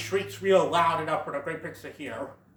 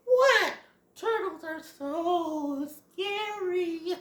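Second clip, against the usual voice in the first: high, drawn-out frightened squeal; mock fright; 13 semitones higher, much slower than usual. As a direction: squeal it slowly in mock fear. Voicing a scared story character.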